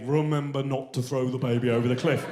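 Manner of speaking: lightly voiced low, measured warning; a hint of an ominous inner voice; brain's message. Brain sending itself a warning, mildly ominous.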